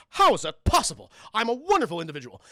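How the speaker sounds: mock indignation. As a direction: act out someone theatrically affronted. Retold character's indignant outburst.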